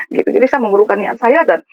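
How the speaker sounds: usual speaking voice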